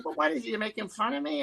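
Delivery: high mock-wary impersonation; Jay Leno impression. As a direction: question it in the high put-on celebrity voice. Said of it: "Hey, it's Jay Leno".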